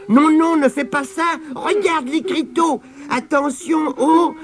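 high, alarmed objection; urgent warning. A narrator's reading. Spider warning Tirondin about the sign, alarmed. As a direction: stop them with a high-pitched warning.

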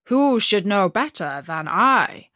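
loud, proud reindeer voice; a beast proud of its home. A narrator's reading. Reindeer boasts to the robber girl about Lapland, loud and proud. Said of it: "said the beast".

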